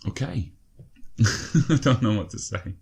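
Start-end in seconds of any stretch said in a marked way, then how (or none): 0.8-1.6 a short breathless disbelieving laugh; breathy incredulous chuckle; stunned laugh. Real, not acted. Laughs after conceding a goal.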